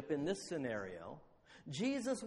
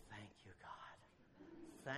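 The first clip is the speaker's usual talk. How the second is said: whispered prayer; 8 semitones lower, 15 dB quieter, much slower than usual. Acted. Silent prayer of relief in heart.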